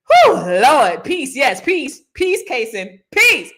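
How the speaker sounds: excited greetings. Real, not acted. Loud greetings to viewers.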